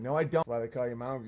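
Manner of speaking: usual speaking voice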